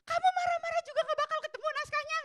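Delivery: high, sharp snapping retort; a mother losing patience with her son, high and sharp; irritated mother. Retold or imitated. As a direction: snap back at the sulking kid. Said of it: "Kira-kira begitu".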